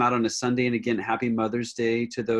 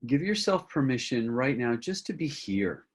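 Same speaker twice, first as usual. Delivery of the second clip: guided centering; 2 semitones higher than usual. Acted. Teacher leading a group with eyes closed, guided meditation. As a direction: gently guide them inward, phrase by unhurried phrase.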